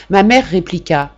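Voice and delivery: ordinary delivery, nothing marked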